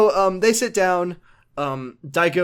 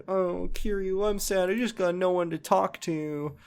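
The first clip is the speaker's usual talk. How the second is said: mock sad; 5 semitones higher, 5 dB quieter than usual. Daigo tells Kiryu he's lonely, a mock sad whine. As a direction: whine it like a lonely spoiled kid. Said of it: "he's like".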